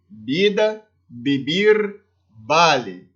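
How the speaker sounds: "Vida, beber, vale" with b replacing every v. Shown as a demonstration. Teacher repeating Spanish V examples, B sound in "Vida, beber, vale". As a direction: pronounce "Vida" as bida, "vale" as bale. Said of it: "vai ter sempre o som de B".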